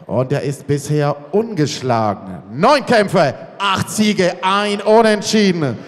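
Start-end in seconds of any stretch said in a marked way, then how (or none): none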